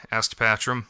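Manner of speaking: ordinary delivery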